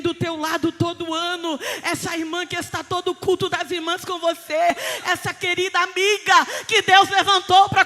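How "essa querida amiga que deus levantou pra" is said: climbing, ever louder exhortation; full-force preaching. Acted. Preacher urging a sister to intercede, fervently building. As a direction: build steadily toward a shout.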